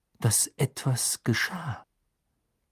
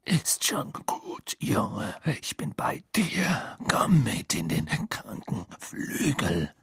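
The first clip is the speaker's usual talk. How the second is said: slow, breathy, rasping reassurance; rough coaxing; slower than usual. A narrator's reading. Man steering Harry to hospital, rough coaxing. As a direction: coax slowly in a husky half-whisper.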